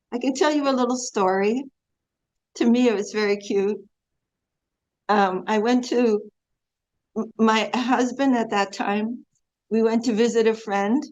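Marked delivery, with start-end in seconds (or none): none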